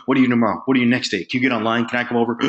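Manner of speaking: breathless mock pleading, questions tumbling out; desperate beggar. Untrained caller begging a prospect for a meeting, mock-desperate. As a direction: beg for any slot, gasping between tries. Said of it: "They turn into beggars".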